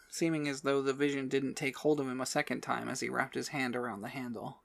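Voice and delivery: ordinary delivery, nothing marked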